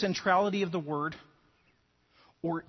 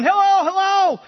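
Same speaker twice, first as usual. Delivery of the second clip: loud, high call across the island; a stranded man yelling for help; 12 semitones higher, 11 dB louder than usual. Acted. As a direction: shout hello as if searching for anyone. Castaway calling out on island.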